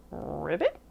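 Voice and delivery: low rolled-r croak, sliding up; pig's frog croak. A narrator's reading. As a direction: croak it like a frog, ending on a rise.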